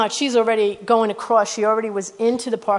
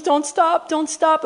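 higher-pitched, pleading imitation; urgent retold plea; 7 semitones higher, slower than usual. Woman with her face in the preacher's hands, imitated urgent pleading.

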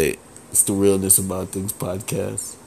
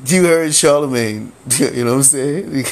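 louder, laughing as he says it; laughing excitedly; 6 semitones higher, 5 dB louder than usual. Real, not acted. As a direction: laugh through the name, amped up. Laughing excitedly about Charlemagne.

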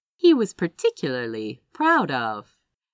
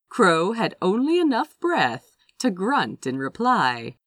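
plain reading voice

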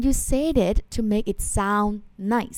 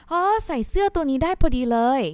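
pleased surprise; 8 semitones higher, 4 dB louder, much faster than usual. Shown as a demonstration.

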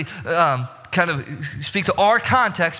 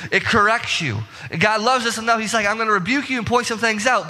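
preaching at full force; 6 semitones higher than usual. Acted. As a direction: preach it hard to the congregation.

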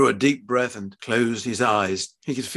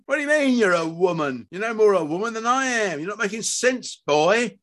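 high, indignant outburst; outraged father berating his son; incredulous anger; 8 semitones higher, 3 dB louder than usual. Acted. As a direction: throw it back in disbelief. Father's angry disbelief.

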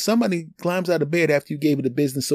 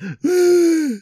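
mock gasp; 6 dB louder than usual. Angry girlfriend arriving.

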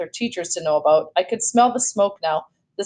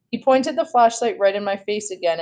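ordinary delivery

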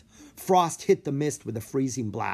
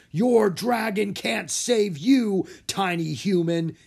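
loud, slow, raised taunt; booming giant; 8 semitones higher, 5 dB louder, slower than usual. A narrator's reading. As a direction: boom down at the tiny human. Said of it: "he boomed".

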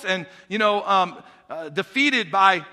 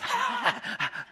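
high breathy laugh; 6 dB quieter than usual. Acted. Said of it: "what the dog does".

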